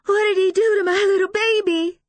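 high, loud anguished wail; crying hard. A narrator's reading. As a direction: cry out in grief. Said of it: "crying so hard".

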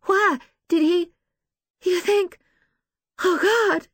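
high, halting panic; frightened woman near tears; fearful, tearful. A narrator's reading. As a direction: break off in dawning horror, voice way up. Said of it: "shine with fear and tears".

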